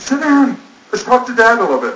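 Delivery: put-on high, bright teen girl urging a friend to sit; daughter's coaxing voice. Daughter urging Erica to talk to her dad, high and eager. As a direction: pull her friend down onto the couch, eager.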